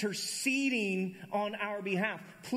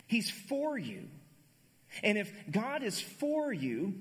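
preaching; 4 semitones higher than usual. Acted. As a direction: lean into each word. Preaching emphasis.

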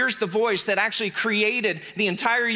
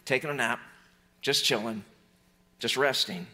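calm, relaxed; 7 semitones lower, 4 dB quieter than usual. Acted. Jesus napping, calm delivery.

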